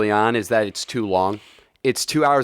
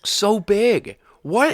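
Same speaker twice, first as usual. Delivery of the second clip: awed voice; 8 semitones higher, much slower than usual. Acted. Awed at actor's traps.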